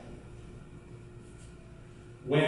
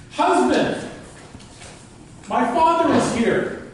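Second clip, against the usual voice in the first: put-on high, eager young woman calling out; eldest daughter voice; 8 semitones higher than usual. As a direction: call your husband in a higher daughter's voice. Daughter calls her troll husband.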